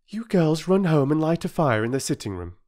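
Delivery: high, soothing mother's voice; mother calming the station master; reassuring mother. Acted.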